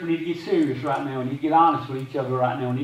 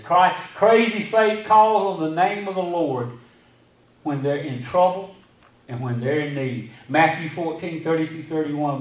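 normal talking voice

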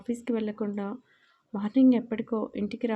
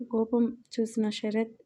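ordinary delivery, nothing marked

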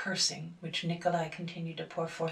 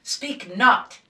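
much louder, rising shout; 6 semitones higher, 15 dB louder than usual. A narrator's reading. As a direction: bellow it as you burst in. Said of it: "he shouted".

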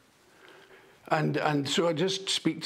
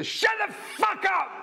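sudden high yelled retort, slower; retold shout. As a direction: bellow it at the heckler. Comic snapping at a heckler.

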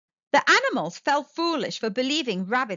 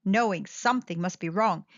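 ordinary delivery, nothing marked

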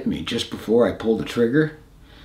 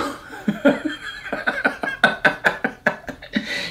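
breathy laugh; 5 dB quieter than usual. Real, not acted. Laugh after Smitty's retort.

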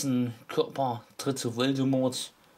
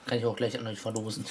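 ordinary delivery

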